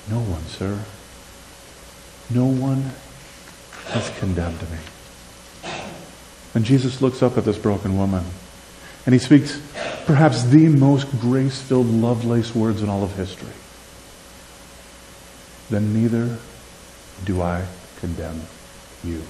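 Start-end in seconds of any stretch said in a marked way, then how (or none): 0.1-5.7 soft, slow, low and hushed; the ashamed woman barely getting it out; hushed voice of a broken woman; quiet broken woman. Acted. Preacher voices the woman's reply. 15.7-19.2 a soft, merciful voice; tender Jesus. Acted. Preacher voices Jesus' gentle words.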